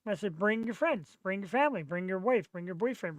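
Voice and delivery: ordinary talk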